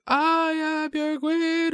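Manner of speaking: high, slow, shouty queen voice; drawn-out regal proclamation; Charlotte queen voice. Retold or imitated. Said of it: "I think that was a very good impression".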